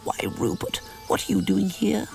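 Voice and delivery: Mary startled to find the bear; surprised. A narrator's reading. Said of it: "Mary asks in surprise".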